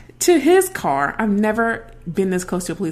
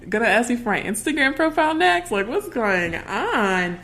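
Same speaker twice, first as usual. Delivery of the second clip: incredulous; 7 semitones higher, 3 dB louder than usual. Retold or imitated. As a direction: act amazed he's flirting. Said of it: "I was like".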